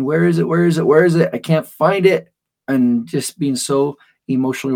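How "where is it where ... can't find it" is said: put-on loud, high upset yelling of a small child; angry tantrum yell. Girl yelling at home in a tantrum, loud and high. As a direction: yell it like a five-year-old who lost her crayon. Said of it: "stomping around, saying".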